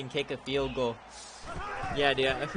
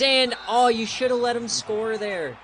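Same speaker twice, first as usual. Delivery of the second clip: higher, louder outburst of exasperation; frustrated shout; 9 semitones higher, 9 dB louder than usual. Real, not acted. Gamer yelling that his team should let them score. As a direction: yell at the bad defensive call. Reacting to a play.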